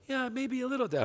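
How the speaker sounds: put-on small, high-pitched boy voice; young son. Son answering apologetic dad, high meek kid voice. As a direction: imitate a kid timidly agreeing.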